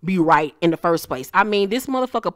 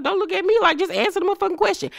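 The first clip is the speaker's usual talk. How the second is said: high, fast, exasperated snap at him; exasperated wife; 12 semitones higher, faster than usual. Retold or imitated. Wife snapping at her husband to answer, high exasperated voice. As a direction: throw it back at him, fed up. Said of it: "She was like".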